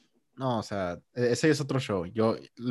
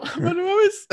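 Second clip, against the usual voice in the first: much higher, loud delighted exclamation; overjoyed; 5 dB louder, slower than usual. Retold or imitated. Son telling his mom he is amazed, bursting with glee. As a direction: burst out with joy.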